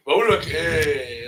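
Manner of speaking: loud Egyptian Arabic call; big Egyptian voice. Shown as a demonstration. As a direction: demonstrate a big, booming Egyptian delivery. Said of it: "It's a big voice".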